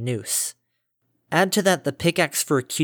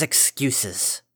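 weary dismissal; 4 dB quieter than usual. Acted. Father dismisses her excuse.

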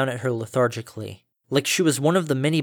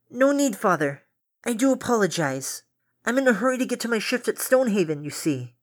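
put-on much higher young female voice; polite young daughter, voice pitched up; daughter's voice; 9 semitones higher than usual. Daughter's voice again.

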